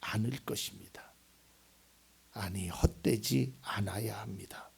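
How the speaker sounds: breathy whispered conviction from the pulpit; hushed whisper. Real, not acted. Preacher whispering to the congregation, hushed. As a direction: drop to a near whisper.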